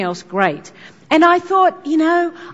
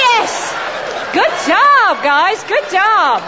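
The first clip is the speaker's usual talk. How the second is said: raised, emphatic praise; enthusiastic cheering; 8 semitones higher, much slower than usual. Real, not acted. Speaker cheers audience after their big ta-da, enthusiastic praise. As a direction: congratulate them with gusto.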